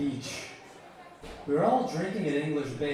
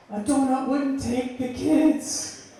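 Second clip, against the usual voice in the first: high, loud, drawn-out cry; shouted punchline; 10 semitones higher, 7 dB louder, slower than usual. Acted. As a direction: belt out the joke like a protest. Performs his written joke punchline.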